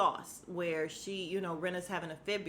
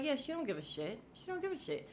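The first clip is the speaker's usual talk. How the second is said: climbing to a high, quiet squeak; mocking aside; 6 semitones higher, 6 dB quieter than usual. Vlogger mocking Rinna's shrug, rising high and soft. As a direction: tease it up into a tiny high voice. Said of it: "I'm like".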